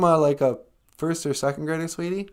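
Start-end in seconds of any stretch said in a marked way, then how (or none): none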